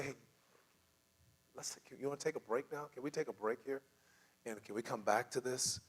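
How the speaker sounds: soft, gentle suggestion; relaxed tone. Shown as a demonstration. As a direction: quietly propose a pause to de-escalate. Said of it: "relax your tone of voice".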